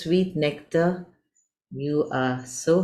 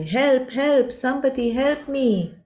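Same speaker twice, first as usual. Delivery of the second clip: shouting for help; 9 semitones higher than usual. A narrator's reading. Scared caterpillar shouting for someone to save it. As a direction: call out for help like a frightened little creature. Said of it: "you start shouting".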